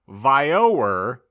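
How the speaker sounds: ordinary talk, nothing marked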